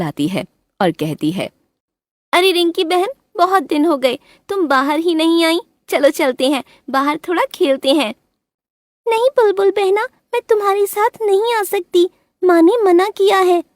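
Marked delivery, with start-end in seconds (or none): 2.3-8.1 high playful coaxing bird voice; friendly bulbul. A narrator's reading. 9.1-13.6 put-on very high childlike bird voice; little bird girl. A narrator's reading. Rinki refuses.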